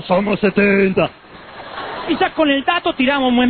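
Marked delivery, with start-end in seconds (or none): 0.0-1.1 slow, drawn-out demon voice; hammed-up demonic reply; possessed man. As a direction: answer as a horde of demons, dragging the words. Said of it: "teníamos nuestro propio endemoniado".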